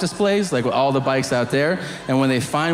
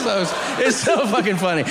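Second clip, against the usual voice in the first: ordinary delivery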